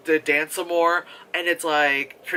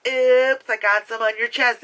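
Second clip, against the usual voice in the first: high, loud, coy oops; mock flirty voice; 6 semitones higher, 4 dB louder than usual. Sister flirting while spilling ice cream, high and coy. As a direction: tease it like a fake accident. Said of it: "And she's like".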